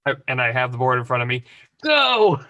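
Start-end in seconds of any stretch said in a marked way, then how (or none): 1.8-2.4 high, loud one-word command; loud, pitched-up urging cry; urgent shout. Acted. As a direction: yell it to hurry them on. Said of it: "and shout".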